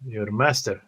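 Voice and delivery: ordinary delivery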